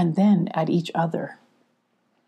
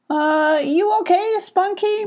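worried; 12 semitones higher than usual. Acted. Molly worried.

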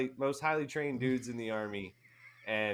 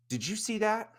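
pitched-up alarmed question; startled question; 12 semitones higher than usual. Retold or imitated. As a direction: ask as if you can't believe your eyes. Asking buddy about blinking light.

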